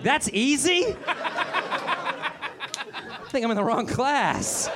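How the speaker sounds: high, laughing disbelief. Real, not acted. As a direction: giggle out the surprise. Laughing at the instruction.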